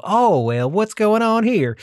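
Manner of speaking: high, put-on southern drawl; hammed-up Texan accent. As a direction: play up the Texas accent to charm. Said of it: "play up the accent".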